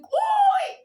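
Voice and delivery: sudden piercing shout of alarm; scream. Retold or imitated. Waking up to a robbery, a sudden high shout. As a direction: yell out in panic. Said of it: "I literally screamed".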